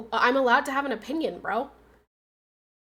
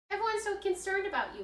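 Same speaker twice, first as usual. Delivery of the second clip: pet talk; 7 semitones higher, 9 dB quieter, faster than usual. Real, not acted. Baby talk to dog.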